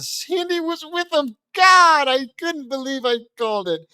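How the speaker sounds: high, loud, hammed-up exclamation; overacted amazement. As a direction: burst out as if overwhelmed.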